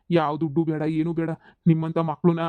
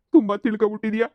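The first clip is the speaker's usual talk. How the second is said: higher, louder, breaking into tears on ತುಂಬಾ; crying; 5 semitones higher, 4 dB louder than usual. Acted. Father moved to tears by his daughter's words, crying. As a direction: let the praise spill over into a sob. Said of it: "ಅಳೋದ್ ನಿಲ್ಸಿ ಅಪ್ಪ".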